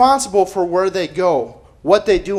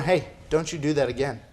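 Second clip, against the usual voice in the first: serious warning; 4 semitones lower, 6 dB quieter than usual. Retold or imitated. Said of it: "I'll be real serious with him".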